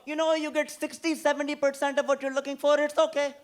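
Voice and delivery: sustained high mock-auntie voice; imitating auntie. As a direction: put on a high-pitched auntie handing out advice. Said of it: "she'll go".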